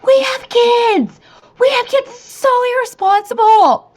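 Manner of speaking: very high, hammed-up shriek; mock-frantic scolding screech; retold screaming. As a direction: scream it after someone skiing away. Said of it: "I'm, like, screaming at him".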